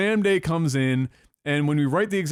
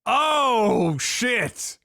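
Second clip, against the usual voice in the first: drawn-out, higher "oh"; shocked reaction; 4 semitones higher than usual. Real, not acted. Listener hearing twelve out of two hundred seventy, shocked. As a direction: stretch it in disbelief, then drop off.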